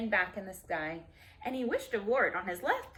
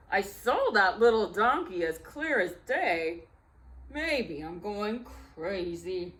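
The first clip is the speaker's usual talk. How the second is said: puzzled lion voice, slowing on the last words; confused lion grumbling as he walks off; muttering lion; 5 dB louder than usual. Acted. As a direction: talk to himself, baffled. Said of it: "he muttered as he walked away".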